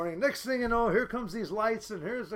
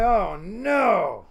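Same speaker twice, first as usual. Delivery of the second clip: loud, drawn-out oh no; big mock-horrified wail; retold dismay; 7 dB louder than usual. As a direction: cry out in dismay, stretching the vowels. Reacting to the wrecked car.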